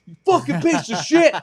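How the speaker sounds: high, loud burst of rage; imitated screamed insult. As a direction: hurl it like a raging stranger. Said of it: "this weird guy who screamed at me".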